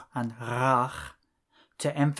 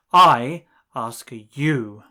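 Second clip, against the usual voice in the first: "I" much louder, slow; stress on I; 10 dB louder, slower than usual. Shown as a demonstration. Teacher modelling emphasis for the learner, stress on "I". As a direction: hit "I" and take it slowly.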